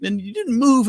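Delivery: high, strained voice at contained volume; retold tearful panic. Friend who thought the climber was dead, retold crying panic. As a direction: imitate a distraught friend, pitched far up. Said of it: "he's just crying, and he's in panic".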